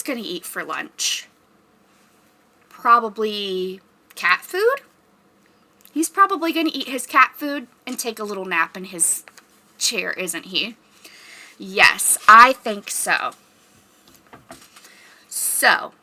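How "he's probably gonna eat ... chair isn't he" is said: plain reading voice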